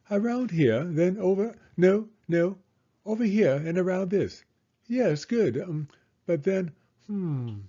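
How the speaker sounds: high, flustered British guide voice; fussy explorer guide. A narrator's reading. The lost guide dithers aloud.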